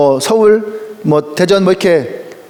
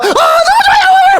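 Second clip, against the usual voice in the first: much higher, slow, laughing shout; gushing mock shout; slower than usual. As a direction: burst out before being asked. Imitates a Gyeongsang member.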